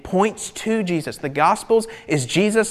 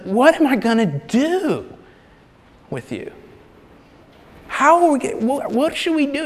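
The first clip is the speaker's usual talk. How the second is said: high, raised pleading questions; exasperated God; 9 semitones higher than usual. Retold or imitated. Preacher voicing God, exasperated and raised high. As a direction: throw up your hands as God. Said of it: "he's to the point where he's like".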